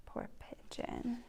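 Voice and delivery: plain reading voice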